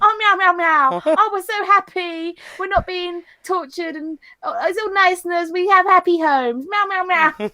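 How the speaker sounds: high, cutesy meowing; happy cats. Pretend. Voicing the happy cats for her social media, high cutesy meows. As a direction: play a blissful little cat. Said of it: "it's going to be happy cats".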